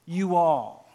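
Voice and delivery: playful Southern "You all." drawled like South Alabama; light South Alabama accent on "You all.". Pretend. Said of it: "Jesus was from South Alabama".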